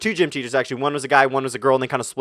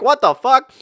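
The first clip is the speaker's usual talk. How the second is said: shocked outburst; 9 semitones higher, 5 dB louder, slower than usual. Retold or imitated. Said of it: "I'm like".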